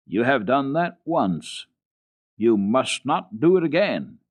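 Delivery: slow, stern warning; stern governess. Acted. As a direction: lay down the rule, slowly. Rottenmeier reproaching slowly.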